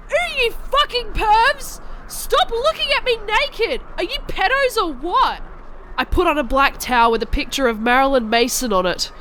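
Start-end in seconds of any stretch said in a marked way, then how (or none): 0.1-5.4 indignant yelling. Acted. Yelling at spying pervs.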